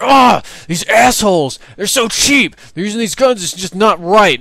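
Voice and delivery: mock a teammate ranting high and loud at cheap players; J-Hub screaming. Teammate raging about the other team's guns, loud high screech. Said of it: "You should have heard him screaming like".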